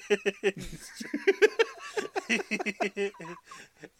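high, loud burst of laughter; long loud laugh. Real, not acted. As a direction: crack up and keep laughing. Laughing at daycare joke.

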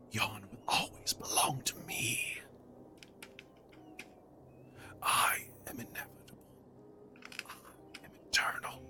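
raspy whispered menace from the puppets; Endelin's raspy voice. Acted. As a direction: rasp it out like a vengeful spirit. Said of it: "Endelin's raspy voice".

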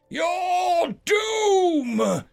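monstrous, very high, roaring shout; thunderous dragon spirit booming doom. A narrator's reading. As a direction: roar it like a reptilian beast. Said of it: "it switched to a thunderous voice again".

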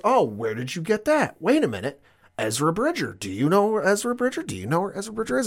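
lightly voiced urgent Sabine; imitating Sabine. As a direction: push the question, voice climbing. Retelling Sabine's eager questions.

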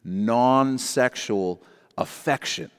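slow and deliberate, word by word; said really slowly. Shown as a demonstration. Pastor slowing down so the men get it. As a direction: spell it out slowly for the slow learners. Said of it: "say it really slowly".

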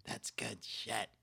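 slow, soft McMahon impression; whispery, slowed McMahon line; quiet McMahon. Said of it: "See, it's too quiet".

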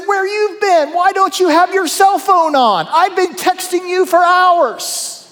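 high, loud, exasperated Peter; frantic Peter. Acted. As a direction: nag him like a worried friend who lost him. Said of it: "Peter sees him and said".

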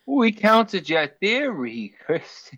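loud, high, drawn-out taunt; mock triumphant call-out. Real, not acted. Co-host heckling a friend over his theory, mock triumphant. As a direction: crow it slowly and loudly, stretching the words.

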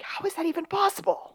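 high incredulous question. Retold or imitated. Retold own exasperated quote.

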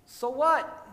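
high, loud rhetorical retort; fervent preaching. Acted. Pastor rebutting a listener's self-doubt, loud preaching retort. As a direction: fire it back at the doubt.

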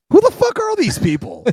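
high-pitched incredulous demand; baffled disbelief. Acted. Podcaster ribbing an unknown cast, incredulous. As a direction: react in squeaky, mock bewilderment.

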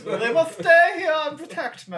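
very high, loud royal whine; queen voice. Pretend. Voicing Queen Elizabeth.

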